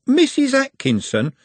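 incredulous. Acted. Trev shocked at name.

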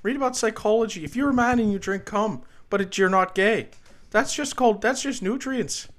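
earnest, aggrieved professor impression; Jordan Peterson. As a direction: rant like an indignant lecturing academic. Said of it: "I got the Peterson".

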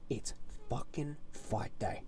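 low, soft and drawn back; hushed build-up. Real, not acted. Fan revealing what day it is, hushed. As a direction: drop it right down before the big reveal.

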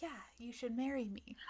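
hushed proposal; whispered. Retold or imitated. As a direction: breathe it like a secret.

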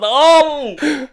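screaming oh. Retold or imitated. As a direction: yell it at the TV. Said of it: "I was like, screaming".

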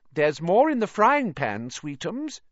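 high-pitched cooing mother; doting aunt. A narrator's reading. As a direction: coo indulgently at a spoilt son. Doting aunt coos to her son.

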